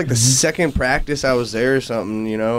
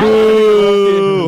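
long, loud, higher-pitched boo; mock booing; 4 semitones higher, 6 dB louder than usual. Bandmate heckles a friend's joke, loud mock boo. Heckles the bad Aussie accent.

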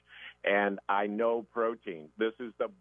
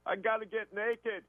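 retold yell; 12 semitones higher, slower than usual. Customer blurting it out to the doctor, high comic yell. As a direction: shout it out like a blurted announcement. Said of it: "he yells out".

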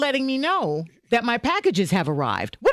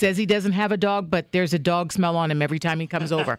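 much lower, gossipy dog translator; low dog interpreter voice; 9 semitones lower than usual. Pretend. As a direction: drop low and dish it like a pet psychic. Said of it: "what's my dog trying to tell me".

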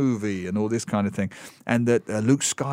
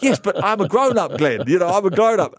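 laughing protest; 7 semitones higher, 4 dB louder than usual. Real, not acted. Laughing defence against teasing.

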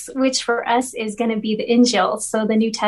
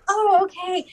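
surprised; 8 semitones higher than usual. Retold or imitated. Taken aback by her reply.

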